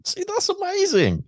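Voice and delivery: excited. Real, not acted. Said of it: "reacting to the poem".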